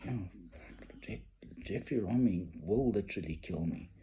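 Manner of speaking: a quiet oh no; dawning dread. Retold or imitated. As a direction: realise the danger under your breath. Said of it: "then I thought".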